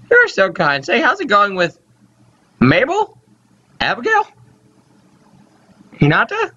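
put-on high old-lady questioning; kindly old mother. Mother asking son about his girlfriend, high old-lady voice.

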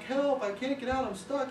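high, lightly voiced frog crying for help; drowning frog. Frog stuck in the milk bucket calling up to the others, lightly voiced high frog. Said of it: "He's like".

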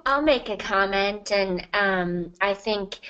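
usual speaking voice

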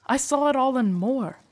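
impassioned declaration; emphatic insistence. Acted. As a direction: insist on it with full conviction. Guard insisting he saw everything.

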